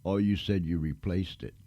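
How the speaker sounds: ordinary talk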